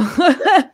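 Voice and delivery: bright burst of laughter; high laugh. Real, not acted. Laugh after excitement.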